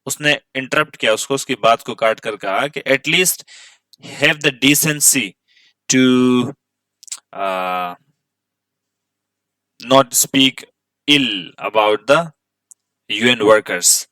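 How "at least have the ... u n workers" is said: reads each word out slowly for the class; careful classroom reading aloud; slow text reading. Shown as a demonstration. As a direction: spell the sentence out word by word. Teacher reads English sentence slowly.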